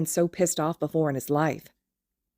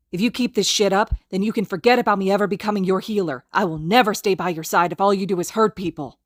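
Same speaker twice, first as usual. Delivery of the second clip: loud, high furious tirade; hiss; 5 semitones higher, 5 dB louder than usual. Acted. Izuku struggling against Kacchan's grip, hissing a tirade. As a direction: unload every grievance at him. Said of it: "Izuku hisses".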